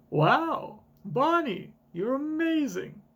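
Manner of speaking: high, slow, delighted praise; high gushing voice. Acted. A grown-up gushing over little Bonnie, high and slow. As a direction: gush over the girl.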